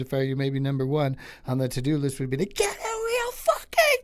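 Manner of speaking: building into a screaming rant; mounting Sam Kinison fury. Acted. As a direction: ramp up to a raw yell. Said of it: "that joke should have been for, like, Sam Kinison".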